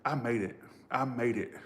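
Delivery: softly overcome, holding back tears; near tears. Real, not acted. Reader takes in having made it, holding back tears. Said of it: "I don't wanna cry".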